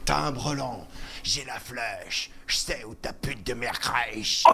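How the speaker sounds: low, slow rapper drawl; rapper impression. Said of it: "il est un peu facile à imiter".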